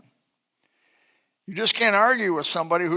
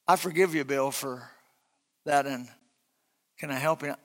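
soft, lower tender forgiving; gentle forgiveness; 4 semitones lower, 5 dB quieter than usual. Pretend. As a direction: disarm him gently. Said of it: "But if you say".

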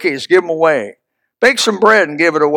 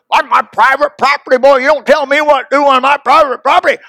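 lightly hammed-up grumpy landowner; gruff neighbor; 6 semitones higher, 5 dB louder than usual. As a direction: play the stubborn neighbor defending his land. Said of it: "I hear him".

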